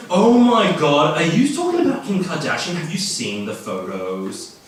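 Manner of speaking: loud, slow gasping Oh my god; gushing gossip. Acted. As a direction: gush like a celebrity-obsessed student. Gossiping student voiced, gushing.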